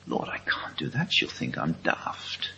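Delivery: whispered inner doubt to God; hesitant whisper. Retold or imitated. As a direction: breathe it as a private worry.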